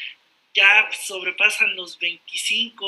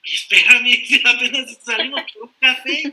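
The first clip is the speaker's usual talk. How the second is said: loud, laughing imitation of a spooked date; jokey, raised-volume "hold on" reaction; mock alarm; 3 semitones higher, 8 dB louder than usual. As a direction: act out startled protest, breaking into laughter. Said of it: "se espanta y dice".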